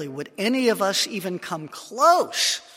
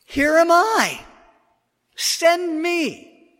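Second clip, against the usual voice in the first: much higher, louder offering; Isaiah's much higher, louder plea to be sent; eager Isaiah; 13 semitones higher, 10 dB louder, much slower than usual. Acted. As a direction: answer like a man volunteering at once. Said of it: "And I said".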